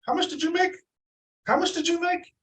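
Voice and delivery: high, incredulous repeat of the question; floored by what the backstage agents made; stunned disbelief. Retold or imitated. Asking backstage agents their income, incredulous and high. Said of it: "I'm like".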